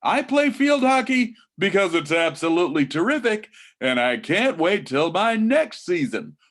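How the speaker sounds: loud, high, over-the-top host enthusiasm; hammed-up game show excitement. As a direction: sell it like a big prize announcement. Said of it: "sound like a game show host".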